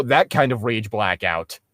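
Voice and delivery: ordinary talk, nothing marked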